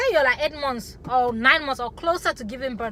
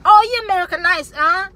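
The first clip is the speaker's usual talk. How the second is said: loud, fast, higher-pitched jeer; mocking accusers; 6 semitones higher, 10 dB louder than usual. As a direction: imitate people calling her out. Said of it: "people say".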